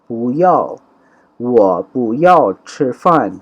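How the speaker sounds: وہ پو یاؤ چھ فان said slowly and syllable by syllable, Chinese tones; slow model Chinese. Shown as a demonstration. Slow, careful Chinese demo. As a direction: Urdu teacher models the Chinese sentence slowly for learners. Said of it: "slow model sentence".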